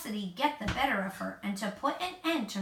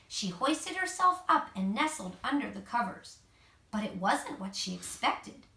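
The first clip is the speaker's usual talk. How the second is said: plain reading voice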